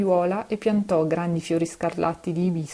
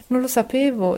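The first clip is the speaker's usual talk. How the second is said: high, louder cry of dismay; shocked cry, pitched up; alarmed exclamation; 7 semitones higher, 5 dB louder than usual. A narrator's reading. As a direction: blurt it out in shock. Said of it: "esclamò la Mem Sahib".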